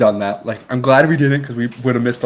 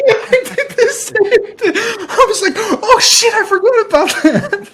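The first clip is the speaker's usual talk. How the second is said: talking through real laughter, much higher; words squeezed out while cracking up; high, loud laughter over every word; laughing hard; 6 dB louder, slower than usual. Real, not acted.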